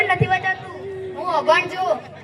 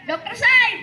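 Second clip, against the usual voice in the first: "Doctor Saheb" called out higher, with "Saheb" stretched and falling; 6 semitones higher than usual. Acted. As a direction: raise the call because he hasn't answered. Calling louder, doctor not answering.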